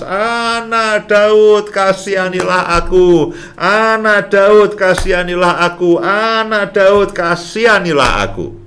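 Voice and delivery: seruan lambat bernada tinggi yang berulang; seruan makin keras. Retold or imitated. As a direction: serukan berkali-kali dengan nada tinggi dan lambat. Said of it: "Dia berseru makin keras". (seruan memohon, lambat dan bernada tinggi)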